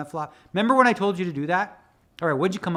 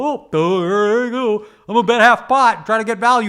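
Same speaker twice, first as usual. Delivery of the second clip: mocking a dummy; 6 semitones higher, 9 dB louder than usual. Mocking players who bet for value without thinking, in a smug voice. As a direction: ham it up as the naive bettor. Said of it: "the dummies who go".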